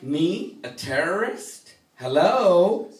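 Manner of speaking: high, rising, camp disbelief; hammed-up indignant questioning; mock incredulous. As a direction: play affronted, building to a loud "Hello?". Acts out his offended reply.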